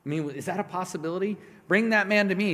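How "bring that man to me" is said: higher, louder command; commanding Jesus. Retold or imitated. Jesus calling for the blind man, loud command. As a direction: call it out over the crowd.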